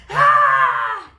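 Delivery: grito muito alto e agudo, do fundo da alma; grito forte. Shown as a demonstration. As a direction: solta um grito de desabafo. (grito de raiva pra desabafar)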